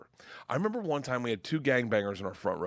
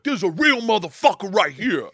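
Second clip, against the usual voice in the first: loud, higher swaggering voice; brash, raised boasting voice; stage-crasher boast; 6 semitones higher, 7 dB louder than usual. Retold or imitated. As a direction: announce yourself loudly to the crowd. Ray boasts on stage, acted.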